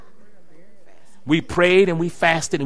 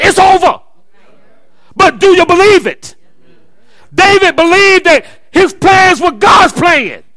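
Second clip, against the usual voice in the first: loud, high preacher cadence; full-force preaching; 8 semitones higher, 9 dB louder than usual. Acted. Preacher rousing the church, loud and fervent. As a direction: drive it home to the church.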